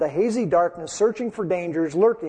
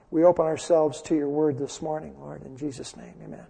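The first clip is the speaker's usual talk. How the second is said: opening prayer; 3 semitones lower than usual. Acted. Pastor prays before the sermon.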